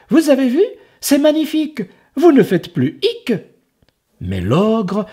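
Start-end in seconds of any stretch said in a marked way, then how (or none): 0.1-3.3 high, mock-delighted boy; exclaiming. Enzo mocking the stricken ogre, high and delighted. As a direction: cry out with fake joy.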